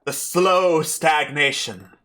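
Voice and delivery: slow, declaimed prologue with swooping pitch; theatrical stage announcer. Acted. Unseen host narrates the town's tragedy, theatrical declaiming. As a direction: intone it grandly like an old-time playhouse host.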